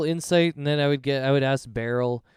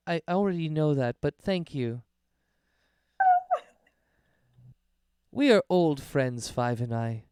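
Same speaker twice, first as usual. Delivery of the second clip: lightly higher, dry and knowing hostess; Errol the amused innkeeper; 4 semitones higher than usual. Acted. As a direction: politely put him in his place. GM voices Errol.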